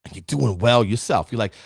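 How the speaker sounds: normal talking voice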